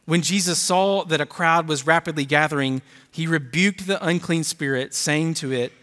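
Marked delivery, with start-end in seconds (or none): none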